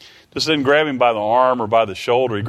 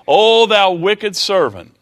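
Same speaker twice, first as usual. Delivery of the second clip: higher, louder, slow condemning cry; stern rebuke; 8 semitones higher, 5 dB louder, slower than usual. Acted. King condemning his unforgiving servant, a slow loud rebuke. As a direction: call him out as wicked, slowly.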